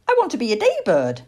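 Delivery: stubborn owlet, rushed; young owl Plop, protesting. Acted. Owlet refusing bedtime.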